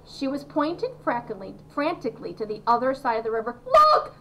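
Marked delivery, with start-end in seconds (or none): 3.6-4.1 high, loud screamed exclamations; excited scream. A narrator's reading. Veruca spotting something across the river, screaming excitedly. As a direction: scream it out to make them look. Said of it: "she screamed".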